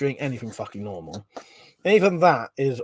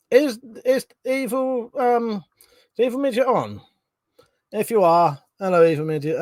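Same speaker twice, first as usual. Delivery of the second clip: playful call-out; 7 semitones higher than usual. Real, not acted. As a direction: call out teasingly to a viewer in chat. Calling out to a chat viewer.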